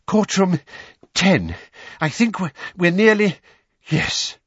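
loud, broken, gasping phrases; out of breath. Acted. Weasley running to find the courtroom, breathless. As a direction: gasp it out between breaths.

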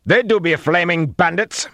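high, loud accusation; agitated cry across a distance; frightened shout. A narrator's reading. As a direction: yell it out, alarmed. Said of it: "a man's frightened voice shouted".

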